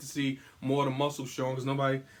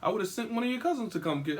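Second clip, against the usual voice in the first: higher, fast worried cousin; imitating worried cousin; 8 semitones higher, faster than usual. Cousin calling about the hospital, higher and fast. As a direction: rattle it off like an alarmed relative. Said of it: "The cousin called me like".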